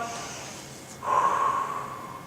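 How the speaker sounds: long soft unvoiced exhale to reset; deep sigh. Shown as a demonstration. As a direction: breathe out the frustration. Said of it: "you're gonna have to go".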